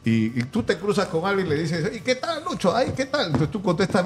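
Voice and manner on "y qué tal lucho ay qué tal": 1 imitating a friendly greeting.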